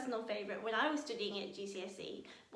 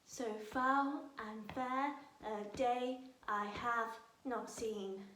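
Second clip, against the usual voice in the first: slow, soft, da-DUM like a heartbeat; heartbeat rhythm; 5 dB quieter, slower than usual. Shown as a demonstration. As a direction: tap it out like a pulse. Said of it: "bang it on a heart".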